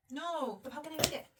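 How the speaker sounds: ordinary talk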